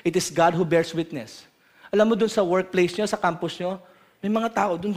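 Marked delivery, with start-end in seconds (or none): none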